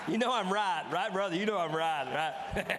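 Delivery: loud, laughing jab at the crowd; laughing tease. Real, not acted. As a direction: rib the audience through a laugh. Teasing the audience, laughing.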